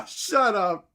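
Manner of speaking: loud, high "shut up" through a laugh; laughing disbelief. Real, not acted. Reacting to the flat earth society's globe joke, loud laughing. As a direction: burst out in delighted disbelief.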